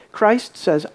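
ordinary talk

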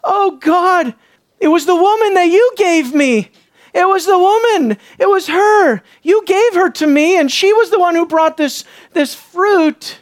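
high, loud mock-desperate protest; hammed-up finger-pointing whine to God; blame-shifting. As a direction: plead your case by pinning it on her. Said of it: "How often do I pass blame?".

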